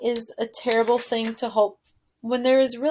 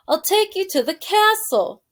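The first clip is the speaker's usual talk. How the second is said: shouted; 8 semitones higher than usual. Acted. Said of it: "shouted the soldier".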